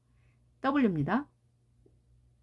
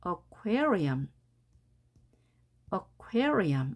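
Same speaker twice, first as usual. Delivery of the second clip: aquarium with QUA raised and strong; stress on second syllable; much slower than usual. Shown as a demonstration. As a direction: hit QUA in aquarium, keep the rest weak. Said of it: "산등성이 올라가는 소리만 크게 발음하고".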